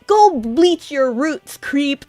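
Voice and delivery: imitated cartoon yell, slow and high; Sailor Mercury yelling. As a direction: draw out the insult like an anime heroine. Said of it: "Mercury then yells at Malachite".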